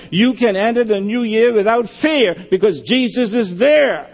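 fervent sermon climax; preaching. Real, not acted. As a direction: lift the congregation toward the promise. Preaching builds to full force.

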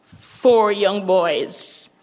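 ordinary talk, nothing marked

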